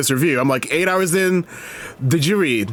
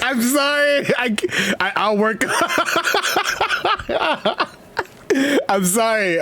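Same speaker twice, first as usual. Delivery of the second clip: helpless laughter; 6 semitones higher than usual. Real, not acted. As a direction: apologise through a real laughing fit. Breaks up during own impression.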